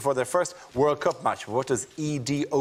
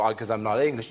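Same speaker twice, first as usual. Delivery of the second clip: ordinary talk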